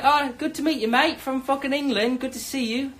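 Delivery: high-pitched, mock English greeting; English accent. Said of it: "Love the accent".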